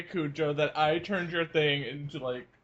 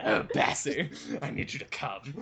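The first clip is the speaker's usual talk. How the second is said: breathy whispered creep, much softer; creepy voice; 6 semitones lower, 4 dB quieter than usual. Acted. Cohost redoes the misread line in a creepy whispered voice.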